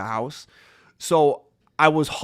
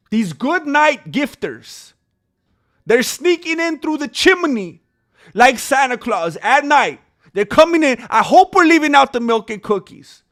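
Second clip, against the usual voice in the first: high, loud, slow build of excitement; excited storytelling hype; hyped Santa hype; 10 semitones higher, 8 dB louder than usual. Acted. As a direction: sell the donors as sneaking Santas. Hyping donors like Santa.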